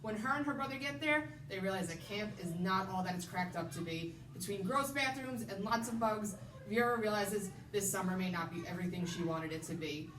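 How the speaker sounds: usual speaking voice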